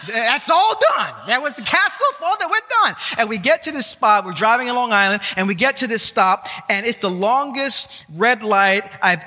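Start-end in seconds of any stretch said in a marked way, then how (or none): 0.1-2.9 mock reassuring dad. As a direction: reassure the boy in a high put-on voice.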